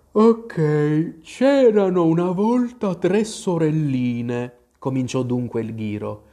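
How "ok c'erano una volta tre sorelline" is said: slow, higher drawling creature voice; drowsy, dragged-out storytelling; sleepy Dormouse. A narrator's reading. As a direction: tell it as if barely awake. Dormouse tells its tale.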